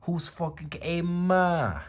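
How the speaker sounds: put-on high, loud Brooklyn-accented shout of hey ma; Joey impression. Vlogger as Joey yelling for his ma, high Brooklyn accent. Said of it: "He sounds like Michael Rappaport".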